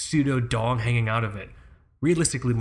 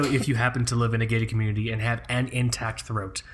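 usual speaking voice

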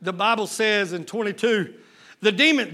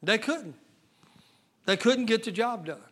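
hushed; 6 dB quieter than usual. Real, not acted. Preacher lowering his voice to the congregation, hushed. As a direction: drop to a soft, sorrowful near-murmur.

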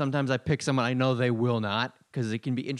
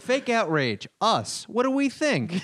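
rising, hammed-up host voice; mock host poll; 9 semitones higher, slower than usual. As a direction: ask the audience like a cheesy host. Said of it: "And then you're like".

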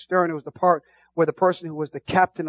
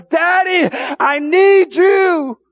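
high, loud, slow cry to God; shouted prayer; 11 semitones higher, 8 dB louder, much slower than usual. Real, not acted.

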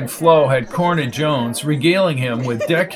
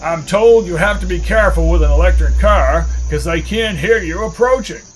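higher-pitched, showy public introduction; grand presenter voice, pitched up; pompous announcer; 4 semitones higher, 4 dB louder than usual. Acted. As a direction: announce it like a self-important emcee. Bucky presents officer to the crowd.

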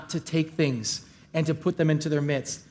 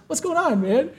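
casual; 10 semitones higher than usual. Retold or imitated. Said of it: "so kinda, like, casual".

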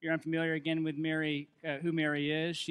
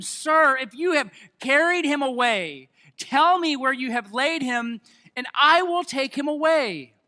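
pleading Mary; 6 semitones higher, 7 dB louder than usual. Acted.

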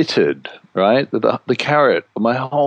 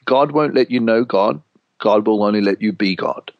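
usual speaking voice

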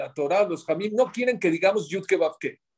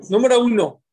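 raised, projected voice; boosted to carry across the room; louder for the back; 5 semitones higher, 7 dB louder than usual. Shown as a demonstration. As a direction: project it so the back rows hear. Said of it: "Ya me regañaron que no se oye".

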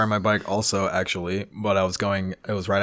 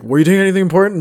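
mock cop; 9 semitones higher, 6 dB louder, faster than usual. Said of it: "the cop's like".

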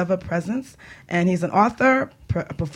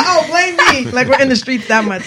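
loud, high mock outrage; playfully protesting the blame, louder and higher; mock indignant; 5 semitones higher, 7 dB louder than usual. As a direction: ham up the offence, laughing.

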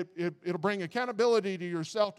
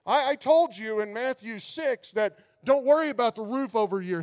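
louder, insistent voice of God; God speaking; 4 semitones higher, 8 dB louder than usual. Pretend. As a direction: preach God's words loud and firm. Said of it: "God is saying".

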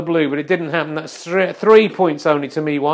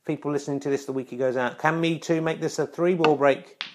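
ordinary talk, nothing marked

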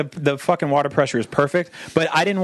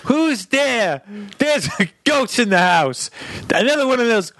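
higher, slow frightened granny; old grandmother; 5 semitones higher, slower than usual. Retold or imitated. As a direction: quaver like a scared old grandma. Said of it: "she'd be like".

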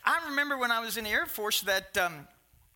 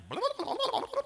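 higher chattery blah-blah; mock chatter; faster than usual. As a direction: imitate endless talking, lightly. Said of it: "That's how much she talked".

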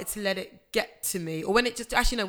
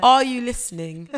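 louder, higher call to the sponsor; playful call-out; 5 semitones higher, 9 dB louder than usual. Real, not acted. Podcaster calling out to a sponsor, loud and playful. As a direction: shout it out to the company. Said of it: "Sponsor this program".

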